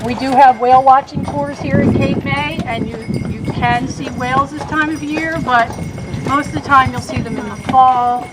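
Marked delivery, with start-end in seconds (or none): none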